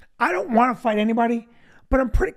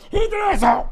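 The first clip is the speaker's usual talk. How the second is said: mock road-rage grumble; 9 semitones higher, faster than usual. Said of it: "look at us and go".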